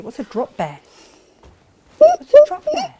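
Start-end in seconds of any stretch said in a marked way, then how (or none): 2.0-2.9 very high, loud squealing question. Pretend. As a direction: squeal it with mock alarm. Repeats the question, then squeals it.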